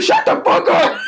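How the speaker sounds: high mock angry bark; angry snap. Boyfriend shouting at a concerned stranger, high mock anger. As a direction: mimic the pissed boyfriend telling someone off. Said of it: "yelling in my face".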